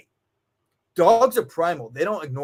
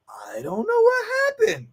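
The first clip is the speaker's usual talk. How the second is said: mocking clueless owner.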